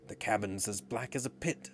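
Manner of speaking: ordinary delivery, nothing marked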